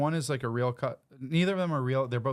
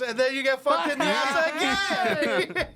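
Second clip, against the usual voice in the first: high, slow, laughing through it; laughing punchline; 13 semitones higher, 4 dB louder, slower than usual. Acted. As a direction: crack up while delivering the punchline.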